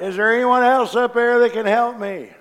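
high, loud frantic plea for anyone else; desperate cry. Acted. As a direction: yell up the cliff in panic, begging. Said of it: "the atheist cried out".

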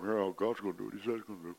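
quiet, toneless mock of listless listeners; unimpressed congregation. Preacher mimicking his unexcited congregation, hushed and hurried. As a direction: mumble it low, fast and very softly. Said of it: "you're all just sitting there going".

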